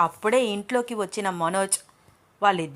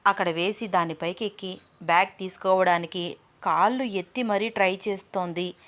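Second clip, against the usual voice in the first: plain reading voice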